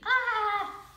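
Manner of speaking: high, loud startled scream; baby-like scream. A narrator's reading. Girl jumping at a classmate's boo, high babyish scream. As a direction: let out a piercing little-kid "Ah!". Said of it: "screamed like a baby".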